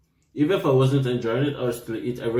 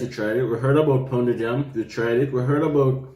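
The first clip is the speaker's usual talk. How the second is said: ordinary talk, nothing marked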